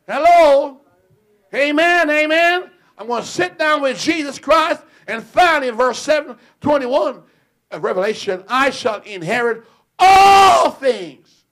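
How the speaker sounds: high, loud preaching shout; preaching at full force. Acted. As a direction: thunder it to the congregation. Preaching climax.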